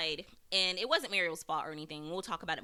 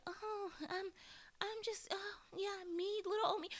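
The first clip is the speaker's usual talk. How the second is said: much higher, meek and helpless mockery; mock weak little voice; 12 semitones higher, 7 dB quieter than usual. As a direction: simper like a helpless damsel. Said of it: "she's acting a little too weak for me".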